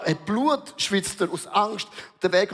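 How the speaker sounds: usual speaking voice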